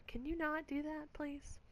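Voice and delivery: pleading aside. Real, not acted. Asking her cat to stop licking a chair, softly pleading.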